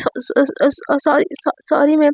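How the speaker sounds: flustered, stuttering sorry; acted nervous stammer; stammered apology. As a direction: stumble repeatedly over the apology. Student apologises after sudden jolt.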